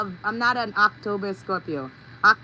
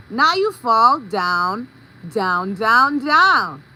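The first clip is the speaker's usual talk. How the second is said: slow, louder down down down; dramatic fall; 7 dB louder, much slower than usual. Acted. She tells of the booby trap, slow loud descent. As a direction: drag out each down like a fall.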